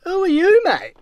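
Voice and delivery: high, incredulous challenge; indignant retort. Retold or imitated. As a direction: snap back in disbelief. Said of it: "I'm like".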